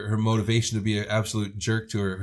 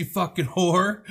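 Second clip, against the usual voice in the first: mock angry slur; 7 semitones higher, slower than usual. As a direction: drag out the insult in mock fury. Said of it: "She's like".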